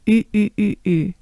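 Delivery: rounded French U, lips pushed out; French U sound. Shown as a demonstration. Teacher demonstrating the French U sound. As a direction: blow it out like into a trumpet. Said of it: "tricky sound for non-French speakers".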